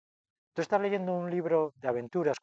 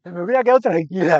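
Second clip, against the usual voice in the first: much louder, laughing quote of the friend; loud amused quote; 5 semitones higher, 13 dB louder than usual. Retold or imitated. Friend's verdict, repeated loudly amid laughter. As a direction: blurt it out, half laughing.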